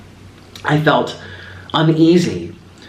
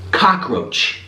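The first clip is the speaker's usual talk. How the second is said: normal talking voice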